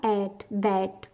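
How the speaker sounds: दैट with a long drawn vowel, a small pause, then the ट; slow classroom demo of दैट; stretched vowel demo. Shown as a demonstration. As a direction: draw out दैट slowly, pause before the final ट. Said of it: "द के साथ ए की sound खींची, फिर थोड़ा pause आया".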